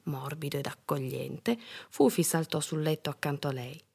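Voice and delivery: plain reading voice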